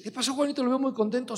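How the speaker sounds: high, bright mock question to a happy friend; playful teasing.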